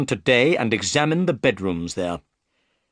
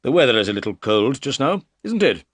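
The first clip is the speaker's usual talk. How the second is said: politely; 3 semitones higher, 5 dB louder, faster than usual. Acted. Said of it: "So he said politely".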